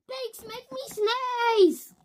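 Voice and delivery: high, loud, slow wail; wailing complaint. Acted. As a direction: wail it in despair. Gerald wails his complaint.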